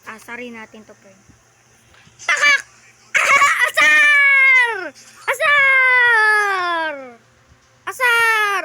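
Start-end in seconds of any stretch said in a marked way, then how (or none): 2.2-8.6 loud, high laughing shouts; laughing yell. Real, not acted. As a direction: crow with laughter at the enemy. Laughing, yelling after taunting.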